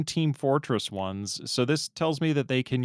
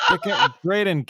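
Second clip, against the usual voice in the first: laughing; 7 semitones higher, 6 dB louder than usual. Real, not acted. Laughing outburst at friends.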